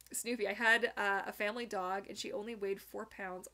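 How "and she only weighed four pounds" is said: ordinary delivery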